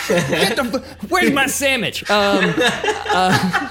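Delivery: laughing mock macho bark for a sandwich; mock chauvinist. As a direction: play a cartoon macho husband. Mock chauvinist yelling at woman.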